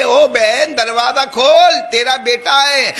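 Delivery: calling out. Acted. Neighbours call out to mother.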